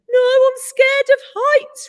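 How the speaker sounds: high, loud frightened protest; scared whine. A narrator's reading. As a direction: cry it out, scared of heights. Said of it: "replied the one with the".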